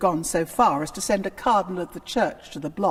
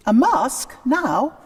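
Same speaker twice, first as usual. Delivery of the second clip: higher, louder startled disbelief; surprised question; 6 semitones higher, 5 dB louder than usual. Acted. Anne reacts to her brother's masque idea, surprised. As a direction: caught off guard, half delighted.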